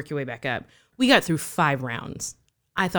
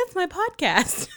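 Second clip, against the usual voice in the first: higher, slower proud announcement; high proud reveal; 14 semitones higher, slower than usual. Retold or imitated. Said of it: "I said".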